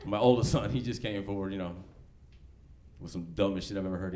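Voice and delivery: ordinary talk, nothing marked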